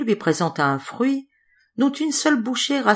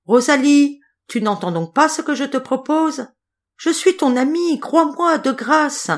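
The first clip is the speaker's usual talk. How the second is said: high, louder, urgent begging; a small mouse begging to be believed; pleading mouse; 9 semitones higher, 4 dB louder than usual. A narrator's reading. Mouse begging silent Rosalie, high and urgent.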